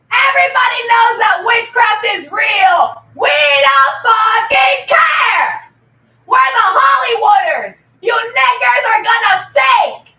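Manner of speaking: loud proclaiming shout; shouted rant. Real, not acted. Ranting loudly at the chat. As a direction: declare it at full volume.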